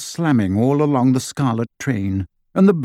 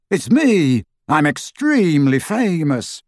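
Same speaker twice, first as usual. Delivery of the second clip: high-pitched playful boast; mock boasting; 4 semitones higher than usual. Ron jokes to the staring children's cousins, mock boasting.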